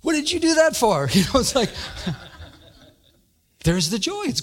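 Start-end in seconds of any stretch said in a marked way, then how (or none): 0.0-1.1 mock indignant outburst. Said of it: "it's like someone cuts you off".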